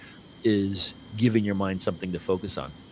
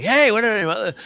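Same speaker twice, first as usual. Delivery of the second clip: mock freaking out; 12 semitones higher, 5 dB louder, slower than usual. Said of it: "And we're like".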